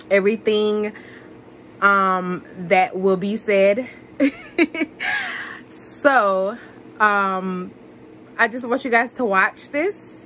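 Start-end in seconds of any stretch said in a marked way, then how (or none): 4.2-5.6 short high-pitched giggle; quick high laugh trailing down. Real, not acted. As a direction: let a bright giggle slip out. Laughs after voiceover remark.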